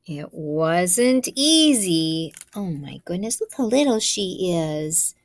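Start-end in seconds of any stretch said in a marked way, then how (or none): none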